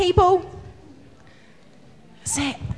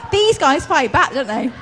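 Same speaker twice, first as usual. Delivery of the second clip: laughing; 2 semitones higher than usual. Real, not acted. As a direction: say it through laughter. Kids throw balls back.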